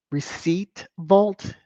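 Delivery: ordinary talk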